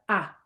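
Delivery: a short, open "ah"; short ah sound. Shown as a demonstration. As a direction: say "ah" short and clipped as a vowel model. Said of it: "a short sound like".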